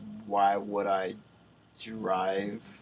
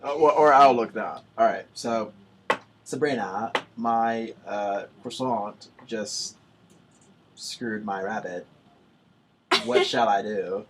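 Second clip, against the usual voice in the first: attempted British accent; 9 dB louder than usual. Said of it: "this may sound a little Australian".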